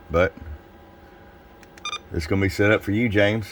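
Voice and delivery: ordinary talk, nothing marked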